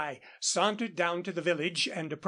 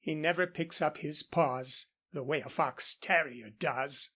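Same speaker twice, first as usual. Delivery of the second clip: ordinary delivery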